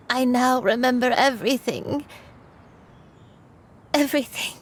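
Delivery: remembering it all, close to crying; tearful. Acted. Granny tells Shuyu she remembers all, tearful. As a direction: voice catching with held-back tears. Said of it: "Granny, please don't cry".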